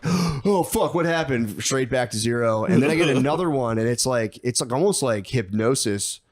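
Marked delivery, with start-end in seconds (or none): 0.0-1.6 high surprised yelp; sudden alarmed outburst; startled panic. Retold or imitated. Breathing video cuts out.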